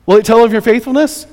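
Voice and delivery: high, loud bargaining question; David. Acted. David bargaining with God for his life, insistent questioning. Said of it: "this bargaining with God".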